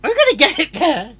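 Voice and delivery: frustrated outburst. Real, not acted. Frustrated after another flub.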